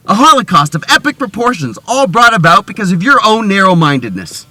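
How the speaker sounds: loud, high doom-laden pronouncement; melodramatic accusation. Acted. Friend blaming a refusal for world doom, melodramatic. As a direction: lay on the guilt at high volume. Overblown guilt speech to persuade friend.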